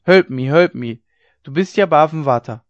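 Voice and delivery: loud pleading shout for help; dying old woman screaming for help; desperate cry. A narrator's reading. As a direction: cry out with the last of her strength. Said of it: "kreischend, wie ein Notschrei".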